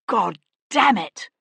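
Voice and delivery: slow, loud angry oath; swearing. A narrator's reading. Bound man swearing at the stubborn magistrate, angry. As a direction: bite out the curse in frustration. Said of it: "Sebastian swore".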